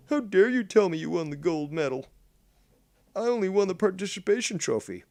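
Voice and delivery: high-pitched mock outrage, timbre put on; indignant sore loser. Participation trophy winner protesting to the gold medalist, mock indignant. Mimics an indignant losing competitor.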